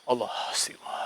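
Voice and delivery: breathless whispered astagfirullah; whispered prayer. Real, not acted. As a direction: whisper it in fear. (bisikan doa ketakutan)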